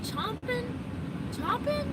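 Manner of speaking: chomping, said high and unsure; chomping as a puzzled guess, rising high; chomping with a questioning lift; unsure pronunciation. Real, not acted. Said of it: "how do you say it?".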